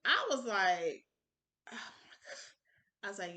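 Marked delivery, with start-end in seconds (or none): 1.7-2.5 whispered, overwhelmed aside; re-enacted awestruck whisper; breathless awe. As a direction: whisper it as if overcome.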